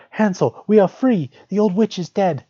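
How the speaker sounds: high, loud and overjoyed; joyful cry. A narrator's reading. Gretel calling to Hansel in the stable, high excited cry. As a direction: cry out the good news, high and bright. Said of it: "and cried".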